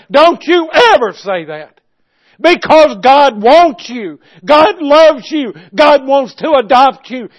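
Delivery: impassioned, loud, high preaching; fervent pulpit cry, every word driven hard. Real, not acted. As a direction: thunder it out to the congregation. Preaches at full force.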